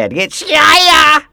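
high, loud, stretched-out shout; yelling. Acted. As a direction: holler the name at him as he walks. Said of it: "just keep yelling".

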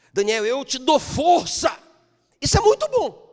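high, loud, slowed declaration; God strengthening Daniel, shouted; God's shout to Daniel. Acted. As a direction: call out the promise with force.

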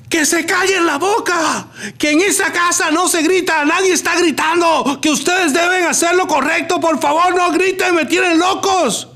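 retold yelling at the kids. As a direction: yell at the kids to shut up, losing patience. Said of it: "yo soy el primero que estoy gritando".

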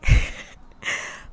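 breathy laugh after the punchline; burst of laughter. Real, not acted. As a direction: laugh at yourself, amused. Laughs after her own line.

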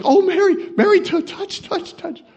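mock squeal, high and breathless; squealing excitement. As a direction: squeal like an excited pregnant cousin. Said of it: "She probably was like".

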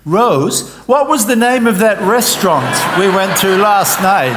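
loud, high call across the room; loud joke punchline shout; shouted question. Acted. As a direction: yell the question to Rose. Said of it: "And the second man says".

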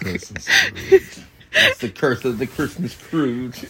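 high, loud laughing jab; laughing tease. Real, not acted. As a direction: laugh it out while teasing her. Teasing partner laughing.

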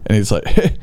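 ordinary talk, nothing marked

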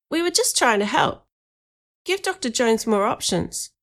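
put-on high-pitched boy justifying himself; mildly defensive young voice. A narrator's reading.

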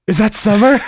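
lightly voiced Seth, rising on Summer; eager Seth. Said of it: "And then Seth goes".

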